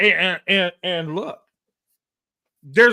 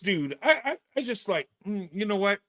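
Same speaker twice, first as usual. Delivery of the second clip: usual speaking voice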